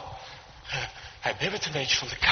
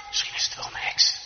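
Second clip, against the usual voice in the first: unvoiced gossiping whisper. Uneasy guests whispering to each other about the old woman, whispering. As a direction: whisper a suspicion secretively. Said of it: "ze fluisteren nog tegen elkaar".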